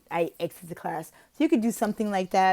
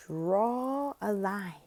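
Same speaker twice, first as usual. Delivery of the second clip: very slow, softer, stretched words; teacher instructing child; 6 dB quieter, much slower than usual. Shown as a demonstration. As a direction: guide a small child word by word. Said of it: "when I'm telling them to draw a line".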